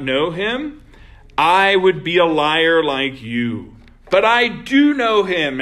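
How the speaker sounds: forceful scripture reading; preaching scripture. Acted. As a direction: proclaim it from the pulpit. Reads Jesus's words aloud, raised.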